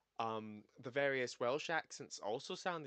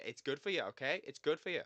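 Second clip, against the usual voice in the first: louder, mock-exasperated insistence; emphatic insistence; 3 semitones higher, 8 dB louder than usual. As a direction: insist loudly, as if not believed. Insisting against a doubting listener.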